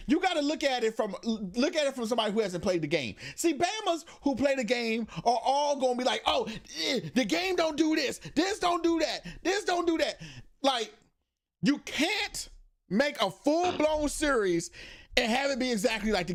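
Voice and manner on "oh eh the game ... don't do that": high-pitched imitation of nitpicking fans; mock complaining. Mocking people who played the game, high complaining. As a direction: whine like a picky fan, raised pitch.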